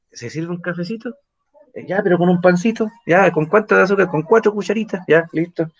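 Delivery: playful mock Chilean host and guest; typical Chilean chatting over coffee. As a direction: act out a cosy Chilean exchange over coffee. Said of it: "el chileno dice".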